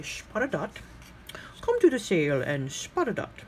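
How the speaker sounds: imitating an ad announcer's odd accent with a slurred "sh" for s in "Spot" and "sale"; weird announcer accent. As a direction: sell it like a quirky radio ad announcer with a strange accent. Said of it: "the announcer had a weird accent".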